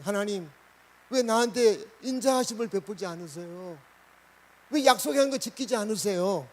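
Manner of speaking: demanding answers from God; frank prayer. Acted. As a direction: act out an honest protest in prayer. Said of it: "이렇게 솔직하게 기도한다".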